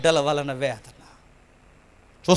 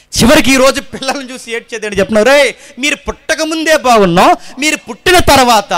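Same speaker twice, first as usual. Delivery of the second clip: loud, high sermon cry to the congregation; loud pleading preacher; fiery preaching; 8 semitones higher, 8 dB louder than usual. Acted. Preaching at full force.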